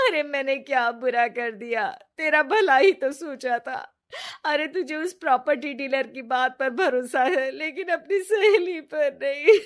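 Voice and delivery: tearful, put-on whine that rises into a sob; fake crying. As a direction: cry to win her friend's sympathy. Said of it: "जोर जोर से रोने लगती है".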